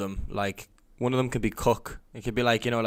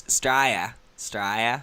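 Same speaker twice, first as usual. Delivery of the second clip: one word in broad Australian; Australian accent; 4 dB louder than usual. Shown as a demonstration. Host showing the little Australian he can do, Australian accent. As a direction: show off a short Aussie accent. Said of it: "Yeah, I can do, I can do".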